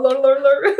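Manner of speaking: loud, high mock gobbling; Garfield gobbling noise. Picturing herself eating in bed, silly gobbling noise. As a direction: gobble noisily, playing it up for laughs. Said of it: "Like Garfield the cat".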